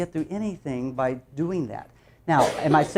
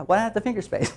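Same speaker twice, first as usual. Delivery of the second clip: quoting colleague; 7 semitones higher, 3 dB quieter than usual. Retold or imitated. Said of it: "And she said".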